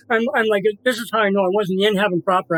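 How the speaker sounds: usual speaking voice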